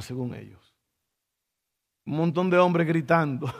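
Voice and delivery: usual speaking voice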